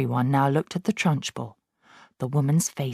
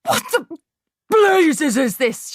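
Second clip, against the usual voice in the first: much higher, loud, then slow; yelling; 12 semitones higher, 6 dB louder, slower than usual. A narrator's reading. Headmistress yelling at the writing chalk. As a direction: bellow in shock. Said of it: "yelled the Trunchbull".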